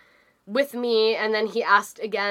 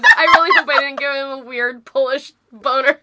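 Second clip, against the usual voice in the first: laughing; 4 semitones higher, 8 dB louder than usual. Real, not acted. Laughing while worrying aloud.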